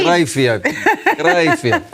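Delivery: very high, laughing taunt; laughing mock taunt. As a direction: tease it with a laugh. Mocking gloat, laughing.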